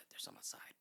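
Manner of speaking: soft whispered report; retold spooked whisper; hushed warning. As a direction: whisper it to a sleepy friend. Telling sleepy friend, frightened.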